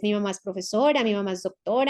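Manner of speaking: usual speaking voice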